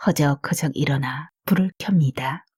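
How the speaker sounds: ordinary delivery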